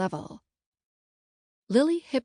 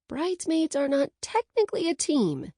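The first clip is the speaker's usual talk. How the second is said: higher-pitched protest; arguing; 7 semitones higher than usual. A narrator's reading. Bridesmaid disputing the bride's claim, arguing. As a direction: argue the point in a raised pitch. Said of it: "I argue".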